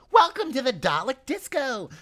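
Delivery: high excited squeal; excited shriek. Acted. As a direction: shriek it like a party host. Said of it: "he shrieked in excitement".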